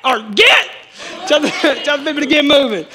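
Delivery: loud, fervent call with a laugh breaking in; preacher leading a loud call-back; said like you mean it. Shown as a demonstration. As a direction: command the crowd to get moving. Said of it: "You better say it like you mean it".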